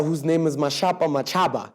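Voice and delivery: ordinary talk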